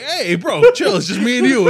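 high, loud laughing plea. Retold or imitated. Telling his rap partner to chill, laughing loud. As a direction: beg him to chill while cracking up. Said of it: "And I was like".

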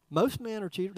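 deadpan joking jab; mock aside. Pastor teasing the congregation with a fake aside, deadpan. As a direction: toss it off like a sly joke. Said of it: "I'm just kidding".

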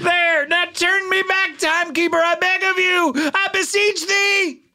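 high, slow theatrical begging; high pleading beg. Acted. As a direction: beseech him like a melodrama. Begs timekeeper archaically.

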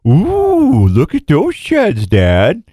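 high, drawn-out ooh, then slowing and dropping; excited dog's voice. Acted. Host voices his dog spotting horns to its owner, excited dog voice. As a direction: voice an awestruck dog, starting high and stretched.

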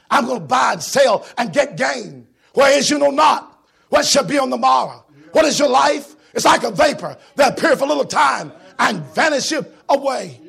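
loud preaching read; preaching scripture. Acted. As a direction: proclaim the verse. Preacher quoting scripture, shouting.

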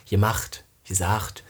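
Halle dialect, soft j for g; low, offhand Halle dialect demo. Shown as a demonstration. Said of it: "das ist so ein Dialektding".

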